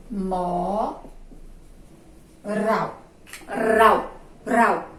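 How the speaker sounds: "Moral" split with a long "mo", pause, then "Rau" repeated harder; syllable separation. Shown as a demonstration. Teacher separating the word again, drawn-out syllable then stressed ending. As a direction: draw out "mo", then give "Rau" on its own, emphatically. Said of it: "Vamos separar".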